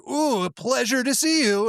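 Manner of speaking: high, slow sugary voice; cutesy box voice. Retold or imitated. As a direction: coo it like an overly cheerful package. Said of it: "they're like".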